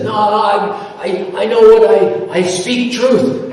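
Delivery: high, slow, insistent imitation; self-righteous man. The attacker insists he speaks the truth, high hammed-up insistence. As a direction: ham up his pompous claim to truth.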